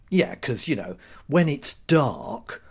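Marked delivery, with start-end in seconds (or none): none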